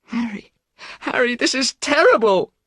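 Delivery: high, loud cry of horror; anguished cry. A narrator's reading. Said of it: "cried Dorian".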